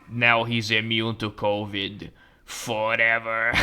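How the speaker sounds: slow, drawn-out villain voice; ominous villain. Acted. Doctor declaring the patient immune, mock ominous. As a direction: pronounce it slowly and ominously.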